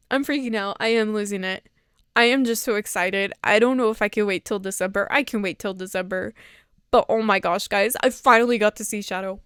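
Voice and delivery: ordinary talk, nothing marked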